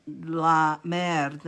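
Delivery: slow French "la merde" with a French r. Real, not acted. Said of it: "I don't know why I'm speaking French".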